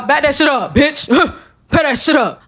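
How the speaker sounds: high, put-on diva voice; mock sassy voice. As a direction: sass the tears away in a played voice. Fighting back tears, joking.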